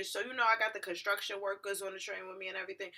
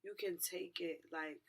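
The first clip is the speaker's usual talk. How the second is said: slow, soft, weary pleading; subdued plea; 3 semitones lower, 5 dB quieter, slower than usual. Retold or imitated. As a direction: offer it quietly, drawing the words out. Acts out pleading with the driver.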